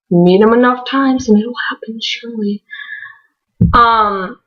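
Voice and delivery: ordinary talk, nothing marked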